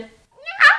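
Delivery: very high, loud mewing meow; imitated cat meow. Telling about the cat in the underwear shelf, high loud meow. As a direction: mimic the cat's sudden meow. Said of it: "And then I heard".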